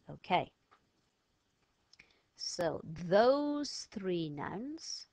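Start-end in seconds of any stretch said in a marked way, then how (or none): 2.4-5.1 very slow, deliberate; slow teacher emphasis. Shown as a demonstration. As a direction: stretch each word for the class. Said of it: "teacher pacing".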